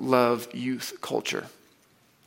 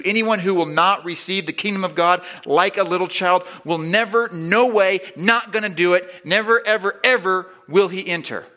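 "never, no way, not gonna do it, never, ever" building higher and louder; maximum emphasis; 5 semitones higher, 4 dB louder than usual. Shown as a demonstration. Preacher paraphrasing Jesus, building to a loud emphatic never. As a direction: pile on the emphasis, climbing on each NEVER. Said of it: "couldn't throw out any more emphasis".

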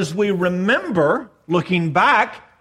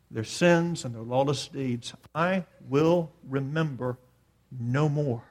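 softer, lower, solemn repetition; hushed quotation; 4 semitones lower, 6 dB quieter than usual. Real, not acted. Preacher repeating God's sworn pledge, hushed and solemn. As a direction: let the promise settle quietly.

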